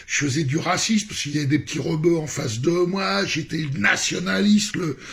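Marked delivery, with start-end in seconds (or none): none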